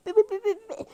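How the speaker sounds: high loud beeping; censor beeps. Acted. Cutting off her own rant, high loud bleeps.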